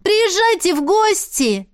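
high, slow farewell call. Acted. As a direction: call after them warmly. Boy calls out farewell.

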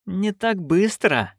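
slow, clipped-off menace; cold drawl through teeth. Acted. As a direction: draw the words out coldly. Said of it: "процедила герцогиня".

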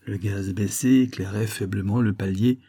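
plain reading voice

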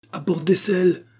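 excited, high-pitched order; urgent cry. A narrator's reading. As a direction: call out in alarm. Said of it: "s'écria Bark".

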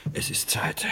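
hushed whisper, very soft. Acted. Man opening the séance for his dead wife, whispered. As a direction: breathe it like the ritual is starting.

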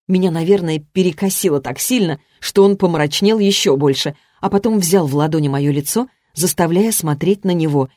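plain reading voice

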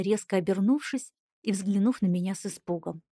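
ordinary delivery

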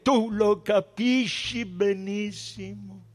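high voice, building to a loud declamation; defiant high priest. A narrator's reading. Caifa vows to defend his people, high and increasingly loud. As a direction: start drawn out, swell into fierce defiance.

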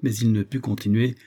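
ordinary delivery, nothing marked

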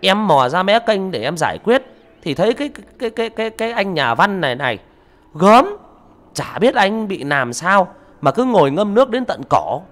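high, loud chattering account; booming gossip. Acted. Neighbour loudly telling villagers how she found him, high and loud. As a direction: tell it to the whole crowd at full volume. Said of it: "Bà ấy oang oang nói".

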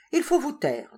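high, firm narrated command; firm, clear order. A narrator's reading. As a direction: order silence crisply and clearly. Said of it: "dit-il nettement".

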